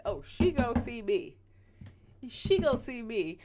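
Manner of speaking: loud, punchy mock determination; imagined attention-seeking commenter. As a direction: play the fan insisting on being noticed. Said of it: "They like".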